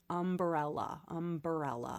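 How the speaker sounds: "umbrella" as um-buh-RELLA, four syllables; extra-syllable umbrella. Shown as a demonstration. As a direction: slip an extra vowel between b and r in "umbrella". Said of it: "I pronounce it".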